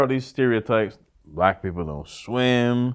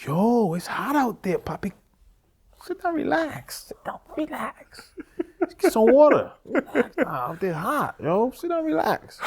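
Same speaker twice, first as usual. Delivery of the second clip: high, soft, slow caring voice; high soft voice of a kind coworker; friendly coworker voice; 14 semitones higher, 3 dB quieter than usual. Retold or imitated. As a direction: coax him to rest like a worried coworker. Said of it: "They're like".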